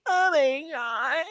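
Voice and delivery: high, very slow mock moan; parody moan. Mimicking the moaning guy for his friend, high drawn-out moan. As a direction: stretch it out high like the dude in the video. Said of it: "Like, like that".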